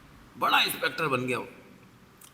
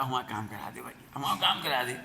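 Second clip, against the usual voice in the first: mock pleading; 4 semitones lower, 6 dB quieter than usual. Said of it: "गिड़गिड़ा रहा".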